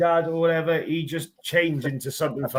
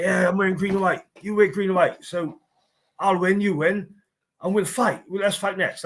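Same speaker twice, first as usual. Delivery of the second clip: a light imitated Plymouth accent, a bit higher; 6 semitones higher than usual. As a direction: mimic a drunk Plymouth fighter in a Plymouth accent. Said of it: "imagine him talking really Plymouth".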